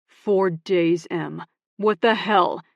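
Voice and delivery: loud, exasperated demand; angry confrontation. Acted. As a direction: confront her, fed up. Ox confronts Emmy.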